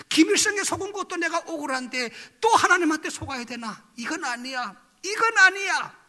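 loud, high-pitched retold outburst of refusal; indignant protest. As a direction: protest loudly against being fooled, reliving it. 속지 않으려는 내면의 항변. (억울해하며 높고 크게 항변하듯)

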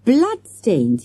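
high, loud exclamation; shouted. A narrator's reading. Boy waving the button at his friends, shouting. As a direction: shout it as a sudden realisation. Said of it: "he shouted".

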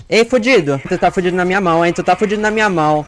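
loud taunting threat; gloating shout. Real, not acted. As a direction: corner the victim and gloat. Cornering a survivor.

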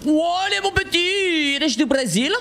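high, drawn-out playful holler; excited calling out. Real, not acted. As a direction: holler it high at the other player. Interpellation moqueuse en plein jeu. (interpellation aiguë et exaltée)

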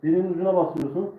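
ordinary delivery

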